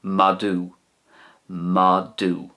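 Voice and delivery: madadh ending in a long oo; Ulster madadh. Shown as a demonstration. Teacher models Ulster dog, madadh ending in oo. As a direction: say madadh as ma-doo. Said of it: "And in Ulster".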